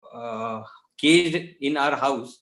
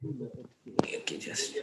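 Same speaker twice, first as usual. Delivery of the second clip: barely audible; 11 semitones higher, 11 dB quieter, slower than usual. Real, not acted. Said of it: "your voice is too low".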